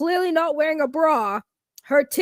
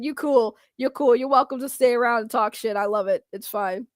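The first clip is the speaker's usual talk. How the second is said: ordinary talk, nothing marked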